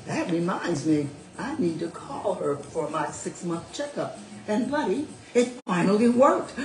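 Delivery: expressive read-aloud storytelling; animated story reading voice; story narration. A narrator's reading. Reads her story aloud.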